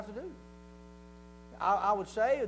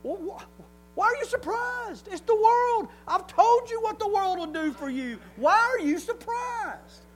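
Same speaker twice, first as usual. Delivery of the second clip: loud, high-pitched incredulous scolding; acted divine exasperation at full volume; exasperated God; 11 semitones higher, 8 dB louder, slower than usual. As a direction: call them out in disbelief, stretching the last question. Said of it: "God's looking at us going".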